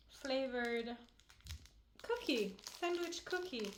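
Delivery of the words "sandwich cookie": ordinary talk, nothing marked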